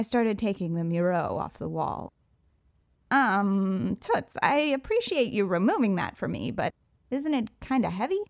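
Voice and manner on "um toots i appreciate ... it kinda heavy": Riddler voice. Acted. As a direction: play the smug showman. Riddler banters with the burglar.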